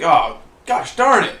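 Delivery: loud, high, drawn-out mock cussing; exasperated outburst. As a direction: blurt it out slow and big, mock-frustrated. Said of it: "it'll come out when we least expect it".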